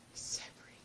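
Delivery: soft admiring whisper. Real, not acted. Admiring the butterfly ribbon, in a soft whisper. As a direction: breathe it out in awe.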